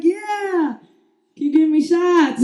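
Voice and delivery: high, loud hollered agreement; re-enacted party yell; excited drunk cheer. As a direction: whoop along like a tipsy fan. Joining drunk dad's cheering.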